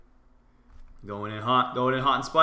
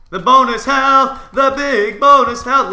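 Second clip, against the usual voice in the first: slow, high, very loud holler; gleeful full-volume yell; excited shout; 12 semitones higher, 14 dB louder, slower than usual. Real, not acted. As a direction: belt it out in the thick of a fight. Yelling mid-fight about his boost.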